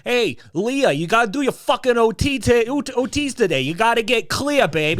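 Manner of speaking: Brooklyn mook. Retold or imitated. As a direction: push her like a pushy Brooklyn dad. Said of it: "It's like".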